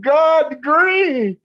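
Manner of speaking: slow, high, loud mocking quote; very slow high mockery; mocking retelling. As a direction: drag it out in disbelief.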